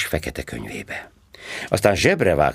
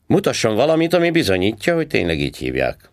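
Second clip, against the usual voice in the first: high, loud mocking cop voice; sarcastic policeman; 6 semitones higher, 3 dB louder, faster than usual. A narrator's reading. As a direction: needle him like a smug traffic cop. Policeman demanding ID. (gúnyos rendőrhang, magasan és hangosan)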